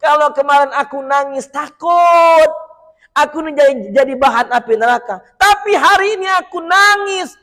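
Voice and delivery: the stone declares its joyful tears loudly; animated stone voice; excited stone. Acted.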